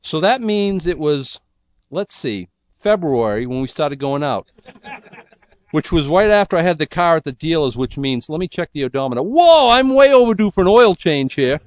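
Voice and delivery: a lightly voiced Fred working out the car's mileage; Fred's oblivious musing. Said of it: "Fred is thinking".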